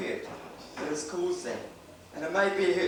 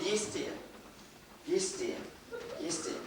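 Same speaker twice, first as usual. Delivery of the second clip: resigned yes dear; 3 semitones higher, 9 dB quieter than usual. Retold or imitated. Weary wife keeps agreeing.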